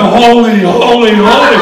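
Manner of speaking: loud, ringing holy, holy, holy; angels crying out. Acted. Preacher voicing the angels in heaven, loud and ringing. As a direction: cry it out like the angels round the throne. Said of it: "they just go".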